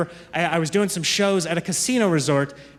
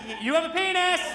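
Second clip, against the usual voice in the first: put-on high child voice singling out strangers; a toddler pointing at people in the store; little girl pointing; 9 semitones higher than usual. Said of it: "She's like".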